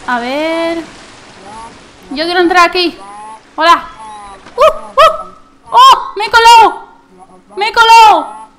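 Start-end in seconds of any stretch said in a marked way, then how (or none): 4.6-5.3 high excited hoots; excited squeal. Real, not acted. 5.7-8.5 loud, higher triumphant cry; gleeful shout. Real, not acted. Gleeful at sneaking in.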